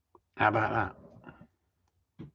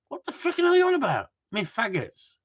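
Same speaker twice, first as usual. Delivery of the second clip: mock outraged retort; 9 semitones higher than usual. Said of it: "I thought".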